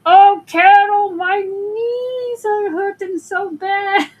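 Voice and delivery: put-on high, drawn-out wail in a Puerto Rican accent; hammed-up whine, very slow, Puerto Rican accent; imitated Puerto Rican accent. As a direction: mimic a Puerto Rican coworker loudly moaning in pain. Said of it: "in her accent".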